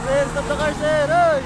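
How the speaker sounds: high, loud yell across a distance; loud desperate call after the car; shouting after a car. Acted. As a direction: bellow to make the driver stop.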